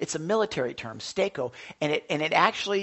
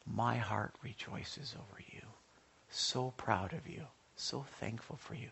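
softer, whispered tenderness; tender hush; 7 semitones lower, 12 dB quieter than usual. Real, not acted. Pastor telling Olivia how proud he is, softly. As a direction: speak gently from the heart.

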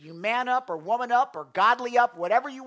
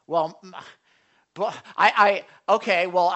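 defensive stammer; 7 dB louder than usual. Acted. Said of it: "you're responsible for your own sin".

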